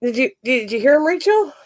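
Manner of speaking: usual speaking voice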